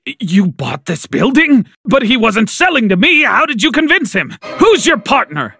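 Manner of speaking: stammered start, then high, frantic questions; desperate and confused. A narrator's reading. Father learning his brother bought the building, desperate. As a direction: blurt questions in rising panic. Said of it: "so desperate and confused".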